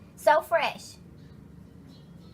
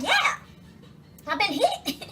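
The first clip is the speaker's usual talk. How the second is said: mock high-pitched insistence, YEAH shooting up; indignant victim; 6 semitones higher, slower than usual. Victim telling husband about being hit, mock-indignant. Voices the shot victim's reply.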